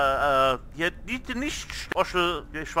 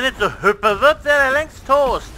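loud, slow exasperated outburst; frustrated shout; 6 semitones higher, 6 dB louder, slower than usual. Real, not acted. Frustrated at hopping boss.

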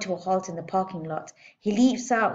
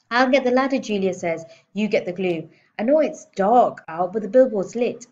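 put-on louder, higher eager girl; 3 semitones higher, 7 dB louder than usual. A narrator's reading. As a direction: rush to get started.

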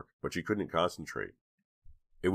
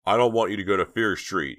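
loud, higher shout to Dina; 4 semitones higher, 7 dB louder than usual. A narrator's reading. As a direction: shout it across the room. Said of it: "he shouted to Dina".

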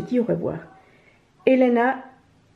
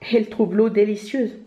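usual speaking voice

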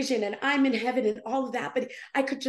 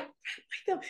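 higher, whispered disbelief; weirded out; 5 semitones higher, 7 dB quieter than usual. Retold or imitated. Puzzled by the strange request, incredulous whisper. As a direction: echo it in a baffled whisper. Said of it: "I found it so weird".

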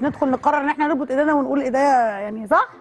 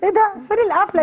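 mock surprise; 7 semitones higher than usual. Real, not acted. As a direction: act shocked there's no ending. Story ends abruptly.

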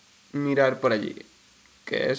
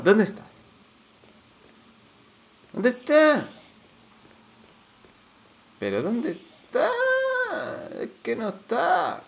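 higher, anxious where-is-it cries; higher, near-crying frantic search; 11 semitones higher than usual. Real, not acted. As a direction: hunt for it in a rising panic. Losing track of enemy.

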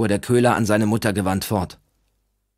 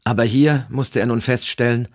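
usual speaking voice

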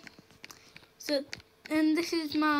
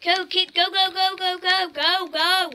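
cheering; 4 semitones higher, 8 dB louder than usual. Acted. Cheering someone on.

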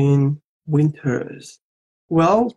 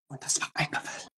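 stern whisper; 14 dB quieter, much faster than usual. Retold or imitated. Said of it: "sounds like sehr aggressiv".

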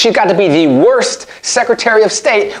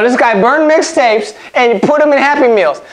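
ordinary talk

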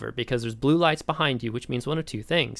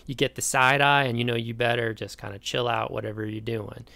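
ordinary talk, nothing marked

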